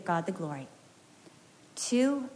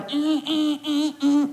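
imitated alarm buzz, higher and growing louder; 4 semitones higher, 4 dB louder, slower than usual. Preacher to congregation mimicking a daughter's alarm, buzzing louder. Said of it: "getting louder and louder".

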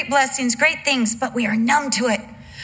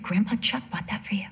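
hushed unvoiced whisper, laying on guilt; mock-solemn whisper to a child; 7 dB quieter, faster than usual. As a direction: breathe it out like a secret. Mother whispers guilt-trip to son.

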